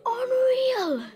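high, loud cry of delight; amazed exclamation. Acted. Girl tasting a pastry, overjoyed and amazed. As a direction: gasp it out, thrilled by the taste.

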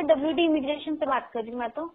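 usual speaking voice

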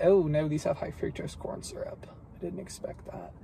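whispered oh no, dropping to a hush; dismayed whisper. Real, not acted. Reading the label to viewers, a dismayed whisper. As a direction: whisper it like a disappointing discovery. Notices corn syrup on label.

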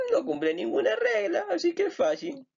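very high, squeaky falsetto; excited pupil. Acted. Acted pupil telling the professor the rule applies, squeaky excitement. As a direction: squeal it like an eager little pupil.

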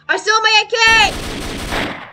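shouting. Real, not acted. Boasting after stealing a gun.

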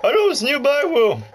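high put-on voice of the lady game guide. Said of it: "Now my voice is getting sore".

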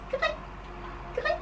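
tiny, short squeak; mouse-sized peep; squeaky mouse. Shown as a demonstration. Practice pattern: squeaky mouse.

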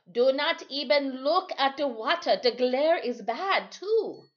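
put-on old sailor's high, firm warning. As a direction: raise the voice high to warn the boy. Timothy warns boy.

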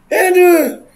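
high, loud cackling laugh; high shrieking laugh then a squealed aduh; burst of laughter. Real, not acted. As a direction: crack up loudly.

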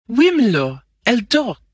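high-pitched, loud French reply; flustered French maid. A narrator's reading. Maid answering milord about her mistress, flustered.

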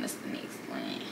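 breathy whispered aside to herself. Real, not acted. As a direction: drop to a bare whisper.

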